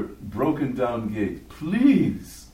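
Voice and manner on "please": high, loud, drawn-out plea; desperate begging. Acted. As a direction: beg desperately at the gate. Beggar keeps begging at the gate.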